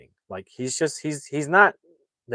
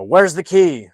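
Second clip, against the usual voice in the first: imitated interrogation; 6 semitones higher, 10 dB louder, slower than usual. Acted interrogation in film recap.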